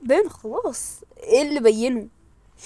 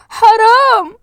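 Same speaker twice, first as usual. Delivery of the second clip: a sharp pleading cry, much louder; loud protest; 9 semitones higher, 10 dB louder, much slower than usual. Real, not acted. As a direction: protest loudly that it is unfair. Pleading protest at unfair accusation.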